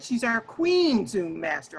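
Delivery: ordinary talk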